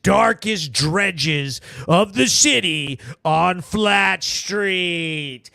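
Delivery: ordinary delivery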